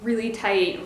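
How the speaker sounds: normal talking voice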